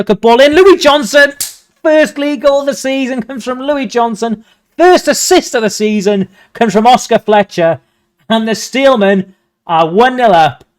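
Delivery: rising, loud goal commentary; a real burst of goal joy; excited goal call. Real, not acted. Goal scored, commentator erupts.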